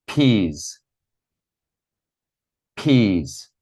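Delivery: each word said slowly and clearly, twice, with pauses; repeat after me. Shown as a demonstration. Teacher has everyone repeat carrots, potatoes, peas after her. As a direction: model each vegetable clearly for the class to repeat. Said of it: "repeat after me".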